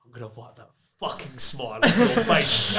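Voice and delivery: quoting the threat through a laugh; half-laughing retold menace; mock threat. Retelling the boss's muttered threat at the photo shoot, laughing. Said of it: "under his breath".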